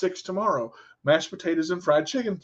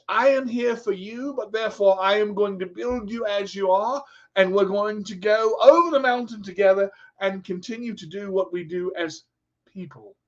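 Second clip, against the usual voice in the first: put-on English accent, raised at first. Shown as a demonstration. Coach vowing to climb alongside a student, English accent.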